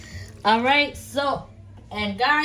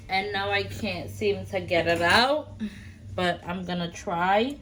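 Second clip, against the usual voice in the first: ordinary delivery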